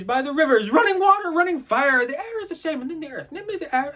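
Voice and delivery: high, loud burst that thins as it goes; frenzied outburst. Acted. Raving about water, fire and air, frenzied. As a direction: cry it out in a rising frenzy. Acted monologue rising to frenzy.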